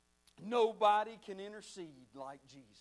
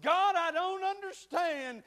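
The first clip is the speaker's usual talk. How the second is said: slow, higher complaint to God; aggravated plea; 6 semitones higher, 5 dB louder, slower than usual. Retold or imitated. Preacher retelling his crying prayer to God, aggravated plea. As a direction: retell a frustrated, tearful prayer. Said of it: "I was getting aggravated".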